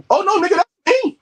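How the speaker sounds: high, loud shocked cry; hammed-up shock; shocked realization. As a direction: discover it's really you, aghast. Realizing photo is his body.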